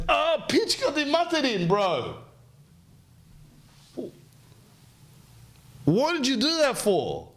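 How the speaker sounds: very high, pained squeal; stung frog. Acted. Stung frog crying out at the scorpion, very high.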